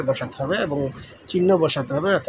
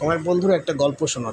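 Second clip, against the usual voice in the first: ordinary talk, nothing marked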